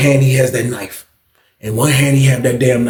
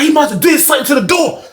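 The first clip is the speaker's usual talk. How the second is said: retold shout; 10 semitones higher than usual. Shouting explanation during chaos.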